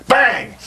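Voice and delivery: high sudden "bang" for a blow; imitated hit. Telling the interviewer how the hit landed, high exclaimed bang. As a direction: punch out the impact noise.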